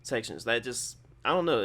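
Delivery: ordinary talk, nothing marked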